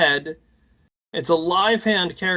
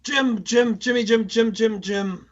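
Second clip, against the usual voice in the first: higher, lightly voiced frantic calling of Jim; a hint of a panicked character calling Jim; quoting the character; 6 semitones higher than usual. Quoting the line again to his cohost as a light, higher panicked call. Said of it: "so many people who quote that".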